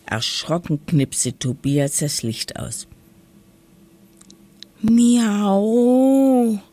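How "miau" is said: a loud, drawn-out, high meow; loud cat meow. A narrator's reading. Cat in the dark cellar meowing loudly at the children. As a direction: yowl like a startled cat in the dark.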